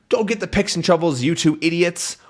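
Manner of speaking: high, quick order; lightly voiced impatient captain; mock bossy captain. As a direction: hurry them, insulting. Retold rude command.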